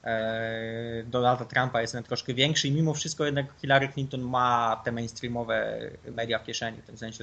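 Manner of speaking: usual speaking voice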